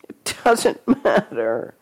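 high, slow exasperated repeat; laughing through words. Acted. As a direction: crack up mid-line. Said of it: "Denny repeated".

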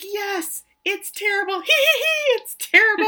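very high fake sobs tipping into giggles; hammed-up dismay that can't hide the glee; mock gleeful lament. [name] secretly delighted by the disaster, mock gleeful lament.

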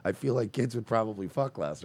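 usual speaking voice

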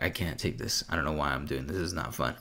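put-on soft, deflated little-kid complaint; quiet, defeated mumble; mock whiny despair. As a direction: sulk like a kid about to cry. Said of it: "I'd just be like".

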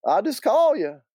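put-on high, thick North Carolina accent; Southern accent running the words together. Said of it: "he replied in a thick accent".

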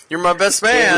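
loud pleading declaration. Acted. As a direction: declare it loudly to him. Loud joking plea.

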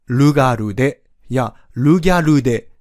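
ルガルデ, ルギャルデ with Japanese ru for each French r; katakana reading. Shown as a demonstration. Teacher reading regarder from its katakana spelling, Japanese-style pronunciation. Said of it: "カタカナで読み方を書くと".

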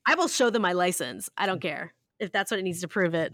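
ordinary delivery

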